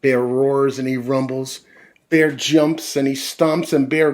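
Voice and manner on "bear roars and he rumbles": plain reading voice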